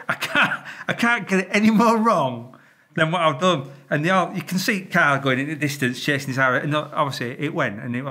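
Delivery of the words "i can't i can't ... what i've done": high, loud exasperated wail; hammed-up helpless outburst; mock despair. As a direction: replay total dismay at your own mistake. Acting out his despair at the blunder.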